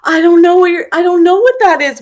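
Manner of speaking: high, loud mock-tearful protest; imitated tearful overwhelm. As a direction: retell her flustered near-sob. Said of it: "she just started sobbing and, like, full body chills".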